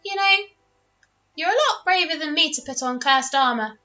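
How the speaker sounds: high, curious little dragon voice; a tiny dragon marvelling. Acted. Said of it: "tilts his head sideways and goes".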